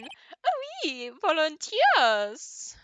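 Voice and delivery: high, drawn-out French chef; gushing, slow French accent. Acted. Chef agrees to tell the court, French accent. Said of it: "I'm going to miss the accents".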